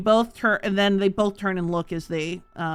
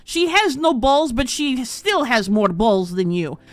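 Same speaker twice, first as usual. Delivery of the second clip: a proud warrior woman sneering, somewhat louder and higher; mocking Brunhilde; 6 semitones higher, 5 dB louder than usual. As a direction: she introduces heroes to shame him. DM plays Brunhilde mocking the townmaster.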